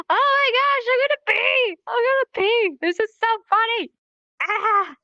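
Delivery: high, hammed-up hysterics; barely contained hilarity. Teacher acting out hard laughter at a movie, hysterical. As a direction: act like you're about to burst laughing. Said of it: "you can barely contain yourself".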